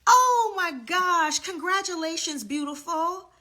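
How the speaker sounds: beam it out to her, overjoyed; thrilled. Real, not acted. Host congratulating a client on stream, gushing and high.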